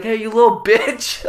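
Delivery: mock heckle. Drunk bowler to sixteen-year-old coworker, heckling.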